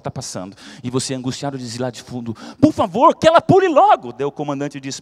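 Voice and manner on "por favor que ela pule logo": loud, high plea to hurry up; impatient shout. Acted. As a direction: yell from the back of the queue. Impatient passenger yells from back.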